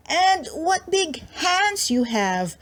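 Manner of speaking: put-on girl stares at the wolf, high and slow; wondering little girl. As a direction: marvel like a small child.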